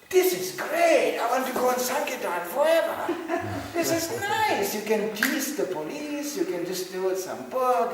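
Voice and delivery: very high, loud and slow, laughing with glee; hammed-up delighted young recruit; giddy excitement. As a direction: gush like a thrilled newcomer. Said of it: "he was going".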